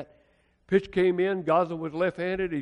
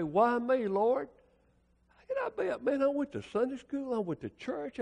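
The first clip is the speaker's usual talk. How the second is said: higher, whiny pleading; mock protest; 9 semitones higher than usual. Sinner protests to the Lord, higher whiny plea. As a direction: complain like a wronged child. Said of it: "jump up and say".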